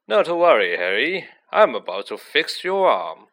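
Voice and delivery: put-on loud, bright-toned showman reassuring the boy; showy Lockhart. A narrator's reading. Lockhart booming to Harry on the pitch, loud showy voice. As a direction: play the vain hero taking charge, loud.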